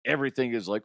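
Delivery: ordinary talk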